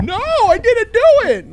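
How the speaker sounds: high, loud, slow wailing denial; crying. Retold or imitated. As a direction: sob it out, teen caught lying. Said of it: "I'm in tears".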